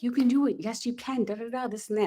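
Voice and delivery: hammed-up rah-rah coach cheering. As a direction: pump them up like a cheerleader coach. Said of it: "I was like a rah-rah coach".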